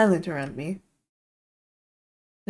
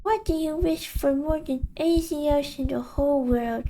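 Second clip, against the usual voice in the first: much higher, sing-song taunting voice; mocking inner voice; 8 semitones higher than usual. The voice in his head greets Iggy, teasing and high. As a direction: tease him like a cruel old friend.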